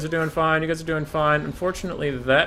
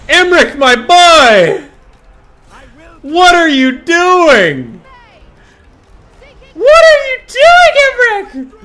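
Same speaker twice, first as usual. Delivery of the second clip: slow, drawn-out wail, much higher and louder; exasperated yelling; 16 dB louder, much slower than usual. Real, not acted. Gamer wailing at Imric for failing again. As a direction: howl in disbelief at the hero. Raging at hero missing attacks.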